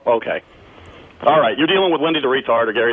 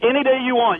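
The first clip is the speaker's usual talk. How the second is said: heated, higher-pitched challenge; worked-up comeback in a quarrel; angry dare; 8 semitones higher than usual. Real, not acted. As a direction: dare him to fight. Real quarrel, daring caller to fight.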